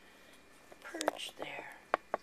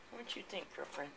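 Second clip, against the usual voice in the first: talking to a pet; 3 semitones lower, 14 dB quieter, faster than usual. Real, not acted. Talking to her cat.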